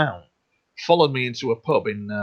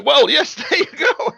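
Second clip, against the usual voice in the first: high-pitched, laughing through the words; 5 dB louder than usual. Real, not acted. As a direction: break into laughter while answering. Amused by host's song request.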